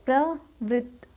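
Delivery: प्रवृत्त said slowly and deliberately; careful pronunciation. Shown as a demonstration. Teacher to students, slowly sounding out प्रवृत्त. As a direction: spell out प्रवृत्त slowly for the class. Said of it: "प्रवृत्त होता है".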